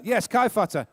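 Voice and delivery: ordinary talk, nothing marked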